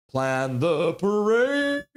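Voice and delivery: drawn-out announcement. Shown as a demonstration. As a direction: stretch every word like a big announcement. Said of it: "Was that pretty good?".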